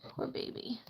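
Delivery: whispered pity. Real, not acted.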